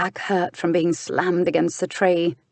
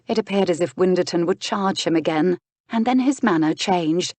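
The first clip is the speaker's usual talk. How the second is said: usual speaking voice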